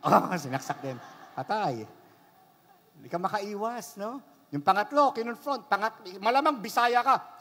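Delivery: normal talking voice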